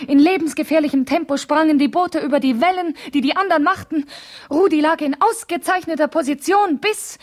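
breathless, swept up in the race; building alarm, voice climbing high; agitated retelling. Acted.

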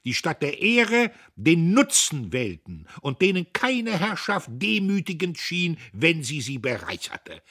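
loud, high public oration; the old man denounces before the crowd; loud, high speech of a warning elder; impassioned old orator. A narrator's reading. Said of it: "rief der Alte".

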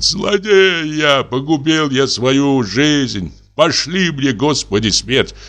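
high, slow, wailing lament; weeping lament. A narrator's reading. Grieving husband weeping over his ruined life. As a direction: wail out your guilt, near sobbing. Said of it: "держится за голову и плачет".

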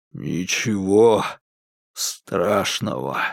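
low, slow, forced out with difficulty; with effort. A narrator's reading. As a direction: squeeze the words out weakly. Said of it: "с трудом произнёс он".

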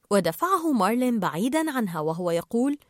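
usual speaking voice

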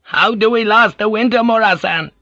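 high anxious villager voice; high-pitched Ninjin fretting about winter; worried fisherman. Acted. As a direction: fret in the high whining voice. Ninjin pleads.

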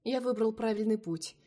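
usual speaking voice

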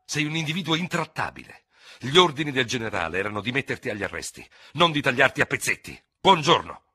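irritated. A narrator's reading. Dubert rebukes the hot-headed Gascon and leaves, irritated. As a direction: lose patience and end the discussion curtly. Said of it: "che cominciava a sentirsi irritato".

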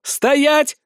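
high, urgent command; sudden shout. A narrator's reading. Leader stopping his fighters just in time, shouting. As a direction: shout it as you catch yourself. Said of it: "кричу, спохватившись".

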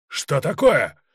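high, loud indignant burst; outraged disbelief. A narrator's reading. Colonel jumping at the insolent Cossack, outraged. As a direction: explode at the insolence. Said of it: "подскочил Черняк".

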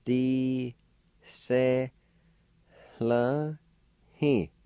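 "D, se, la, he" in long, high, separate syllables; faint H before the L in "la", each syllable apart; syllable-by-syllable pronunciation. Shown as a demonstration. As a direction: hold each of "D, se, la, he" with pauses between. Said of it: "It's pronounced like this".

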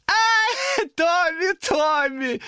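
high, sing-song demon voice; demon chiding. A narrator's reading. Demon chides Thomas in a sing-song voice. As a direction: croon his name mockingly. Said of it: "сказал демон".

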